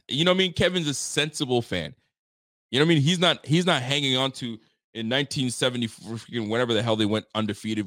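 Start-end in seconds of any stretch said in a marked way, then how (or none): none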